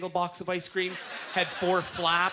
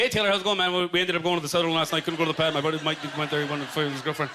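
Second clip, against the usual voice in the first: quick talking; faster than usual. Retold or imitated. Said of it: "he starts quick talking".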